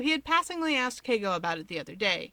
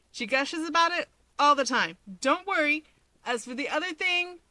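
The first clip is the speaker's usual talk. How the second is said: put-on lightly higher, breezy young man; teasing friend, a bit higher; Keigo's voice; 7 semitones higher, 4 dB louder than usual. A narrator's reading.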